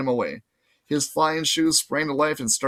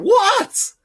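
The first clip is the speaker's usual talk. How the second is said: put-on very high, loud irritated girl; annoyed girl voice; 7 dB louder than usual. Annabeth snapping at Percy again, very high and irritated. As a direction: snap back, irritated.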